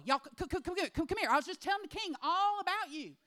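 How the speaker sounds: high, eager calling out; excited calling. Acted. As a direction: Gehazi calling the woman over excitedly. Gehazi calls the woman over.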